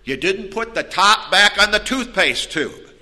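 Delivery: nagging spouse. Acted. Acts out spouses arguing over trifles.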